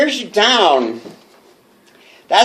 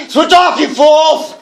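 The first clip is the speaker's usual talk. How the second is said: manic yell; 8 semitones higher, slower than usual. Acted. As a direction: scream it at them like a madman. Madman yelling at listeners.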